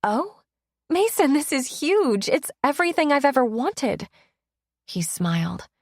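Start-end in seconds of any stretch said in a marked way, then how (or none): 0.0-4.1 higher and louder, bursting with news; thrilled, can't contain it; overjoyed girlfriend sharing big news; excited. Acted. B thrilled about her promotion.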